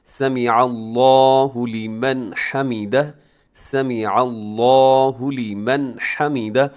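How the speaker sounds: slow chanted recitation with a full, heavy अल्लाहु; drawn-out Arabic chant of हमीदा with a clear short first vowel; slow Quranic recitation; correct recitation. Shown as a demonstration. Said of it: "हमीदा को हामिदा नहीं पढ़ना बल्कि हमीदा".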